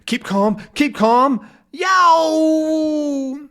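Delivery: high, rising into a loud yow; panicked yelp. Acted. Thompson pinched by the crab, calm breaking to a yell. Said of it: "and he says".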